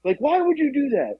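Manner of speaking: high-pitched mock disbelief; incredulous coworkers. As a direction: act baffled that anyone would do it. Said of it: "They're like".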